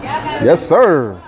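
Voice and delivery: normal talking voice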